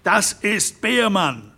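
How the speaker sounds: slow, high shout. Acted. Derrick shouts the name to the guests, slow and high. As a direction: cry out the name. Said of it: "schrie Hermann Derrick".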